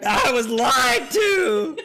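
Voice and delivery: high, drawn-out wail on the edge of laughter; mock-betrayed wail. Real, not acted. Drinker lied to about water, mock-betrayed wail. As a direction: cry out slowly as if betrayed.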